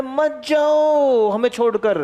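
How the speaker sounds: drawn-out mock begging; pleading molecules. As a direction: play a clingy lover, slowly stretching the plea. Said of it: "कह रहे". (छोड़कर न जाने की नाटकीय, खिंची हुई मिन्नत)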